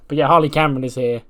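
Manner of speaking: usual speaking voice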